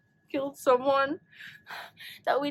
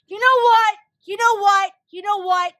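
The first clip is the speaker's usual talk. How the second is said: loud exasperated repeats; angry rant; 3 semitones higher, 8 dB louder than usual. Real, not acted. Viewer loudly fuming at Stephen King. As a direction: fume at the author.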